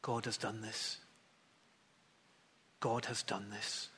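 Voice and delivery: soft and low, tender awe; a hushed, tender voicing of Elizabeth; very gently. A narrator's reading. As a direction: say it gently, in quiet wonder. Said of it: "And Elizabeth just says very gently".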